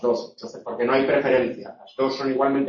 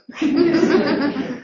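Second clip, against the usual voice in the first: high laugh; 13 semitones higher than usual. Real, not acted. Laughs at movie quote.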